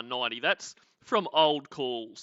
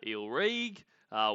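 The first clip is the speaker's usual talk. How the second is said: usual speaking voice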